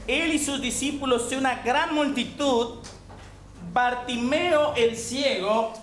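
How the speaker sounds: ordinary delivery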